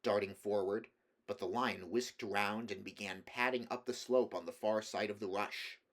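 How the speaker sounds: ordinary delivery, nothing marked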